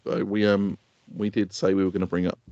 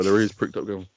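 usual speaking voice

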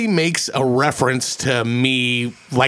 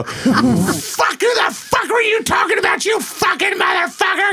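much higher mock shout; angry woman yelling across a fence, mock shriek; retold cussing out; slower than usual. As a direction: imitate a woman screaming at you, way up high. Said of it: "start fucking cussing me out".

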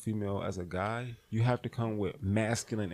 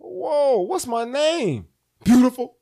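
much higher and louder, slow and hammed up; brash pickup guy; 13 dB louder, slower than usual. Playing the cocky guy hitting on a woman, loud and hammed. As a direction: ham up a cocky pickup artist.

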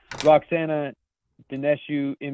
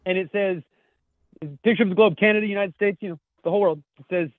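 ordinary talk, nothing marked

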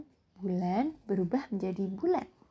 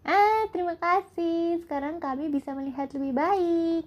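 several high cheering voices; many voices in unison; 8 semitones higher, 4 dB louder than usual. Acted. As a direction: shout thanks as a happy crowd. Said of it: "berbagai suara yang berteriak bersama-sama".

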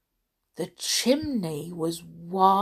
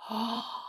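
gasp; 3 semitones higher, 11 dB quieter than usual. Real, not acted. Reader reacts to the story.

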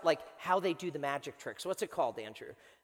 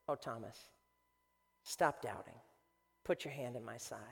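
soft, low, tender voice; gentle reproof; 8 semitones lower, 9 dB quieter than usual. Acted. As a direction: soothe a doubter quietly, with pauses. Jesus gently answers doubting Thomas.

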